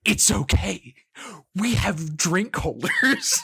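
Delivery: slow, beaming mock reassurance; excited Jake. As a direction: drag out the words like a happy buddy. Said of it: "And Jake just goes".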